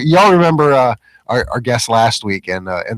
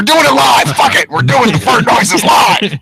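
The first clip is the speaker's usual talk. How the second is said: excited shout; 10 semitones higher, 4 dB louder, slower than usual. Real, not acted. Laughing at the glitching soundboard.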